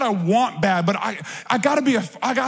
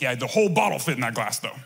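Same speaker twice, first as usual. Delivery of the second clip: deadpan joke; 9 semitones lower, 3 dB quieter, faster than usual. Retold or imitated. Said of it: "I'm like".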